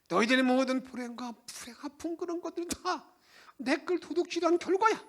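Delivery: high, scolding voice; stern rebuke. Acted. The Lord rebuking the people severely. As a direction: lay the blame on them hard. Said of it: "아주 호되게".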